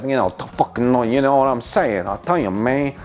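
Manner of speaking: hammed-up Cuban-American gangster accent; badly faked Scarface-style gangster accent; bad Tony Montana accent. Cook joking about beef meatballs, bad gangster accent. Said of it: "Uh, bad accent".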